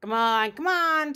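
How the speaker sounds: loud, pushy bird's squawk; loud insistent magpie cry; magpie's insistent call. Acted. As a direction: squawk it at the choking cat. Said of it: "said the doctor".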